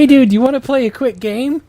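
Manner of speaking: put-on high eager kid voice. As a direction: pitch up as the excited kid brother. Chris voiced in read story.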